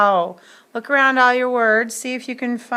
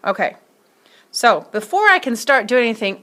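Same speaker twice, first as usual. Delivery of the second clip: ordinary delivery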